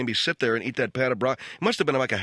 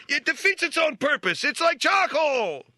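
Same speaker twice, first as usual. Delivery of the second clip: much higher, slow, strained tough-guy imitation of "It's like charcoal!"; Robert De Niro; slower than usual. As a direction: ham up a Raging Bull boxer's complaint. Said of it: "Like Robert De Niro in Raging Bull".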